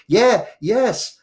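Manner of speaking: high, loud nervous yes; strained yes. Retold or imitated. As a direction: force out the yes. Hesitant answer to mum.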